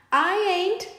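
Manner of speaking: snickering. Acted. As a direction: sneer a cheeky no. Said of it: "snickers Monsieur Otter".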